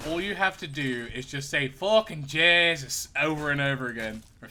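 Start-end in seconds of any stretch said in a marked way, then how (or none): 1.7-3.1 mock Irish, slow and drawn out; slow exaggerated Irish "Fucking Jesus"; Irish accent. Shown as a demonstration. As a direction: lay on a jokey Irish accent. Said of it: "If you want to do an Irish accent".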